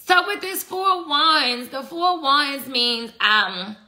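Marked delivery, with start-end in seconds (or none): none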